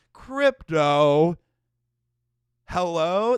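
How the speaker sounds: loud, startled call to a dog. Retold or imitated. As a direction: shout the dog's name to stop it. Everyone calling the dog.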